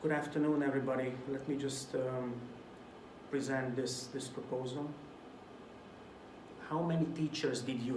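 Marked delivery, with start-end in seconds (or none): 0.0-4.9 much softer, lower, hesitant presenter; bored speaker who loses the room; dull mumbling. Shown as a demonstration. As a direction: mumble it like a boring lecturer. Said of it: "if you talk like this".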